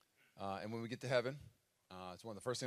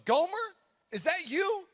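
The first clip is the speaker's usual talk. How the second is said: surprised; 12 dB louder, slower than usual. Retold or imitated. Cindy recognises Gomer, astonished.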